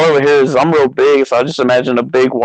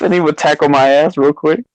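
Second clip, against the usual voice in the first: usual speaking voice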